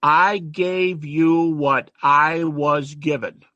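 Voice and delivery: very slow, each word laid down; preacher pointing the class to Paul's exact wording; weighted quote. Shown as a demonstration. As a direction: read Paul's words slowly so they sink in. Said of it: "watch his words".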